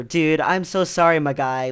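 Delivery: retold over-earnest apology; confused, apologetic. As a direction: imitate a flustered guy's effusive apology. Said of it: "He was very confused".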